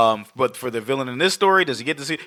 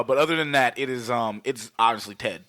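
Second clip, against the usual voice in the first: ordinary talk, nothing marked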